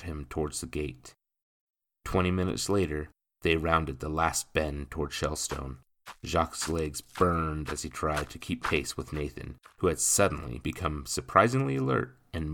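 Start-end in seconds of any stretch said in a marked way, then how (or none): none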